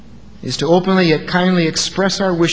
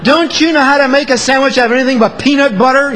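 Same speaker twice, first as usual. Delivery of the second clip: much louder and higher, mocking complaint; loud sarcastic jab about lunch; unkind sneer; 8 semitones higher, 6 dB louder, faster than usual. Shown as a demonstration. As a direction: scold the spouse with contempt. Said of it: "the other is not".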